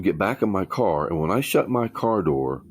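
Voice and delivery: ordinary delivery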